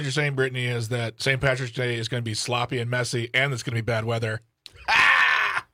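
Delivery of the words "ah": groan at a pun. Real, not acted. Reacting to pun.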